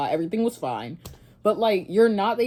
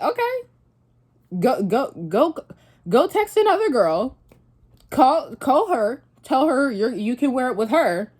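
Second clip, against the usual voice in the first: higher, louder huffy comeback; indignant retort; 5 semitones higher, 3 dB louder than usual. Retold or imitated. Telling her boyfriend to go text another girl, louder and huffy. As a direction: snap back at him, offended.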